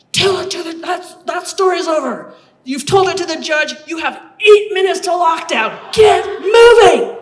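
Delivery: high, loud barking shout, slowing on the last words; guard shouting. Retold or imitated. Prison guard shouting the recruit down, telling her to get moving. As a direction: roar the orders down at her. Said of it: "this really big bruiser of a woman".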